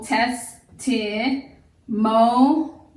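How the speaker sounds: "Test, ti, mo" split into separate syllables; sounding out syllables. Shown as a demonstration. Teacher splitting the word into syllables for students, slow and separate. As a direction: break "Test, ti, mo" apart one syllable at a time. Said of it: "I between I and M is a second syllable".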